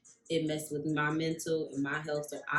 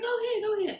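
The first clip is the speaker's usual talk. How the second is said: very high, louder "go ahead"; eager urging; 5 dB louder, faster than usual. Retold or imitated. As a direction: wave her on, eager. Mom urging her kindly.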